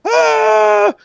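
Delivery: high loud yelp of pain; pained yell. Acted. GM voices the rider hit in the head, yelping. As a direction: yelp as the arrow hits. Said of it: "and he's like".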